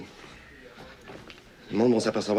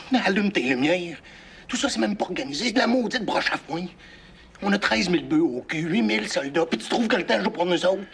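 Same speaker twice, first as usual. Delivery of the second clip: angry; 6 semitones higher than usual. Acted. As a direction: lay into him, getting worked up. Heated argument with comrade.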